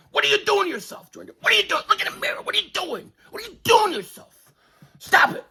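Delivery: shrill high scolding pleas; high-pitched scolding. Acted. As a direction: scold him in a squeaky voice. Scolding Jordan like a parent.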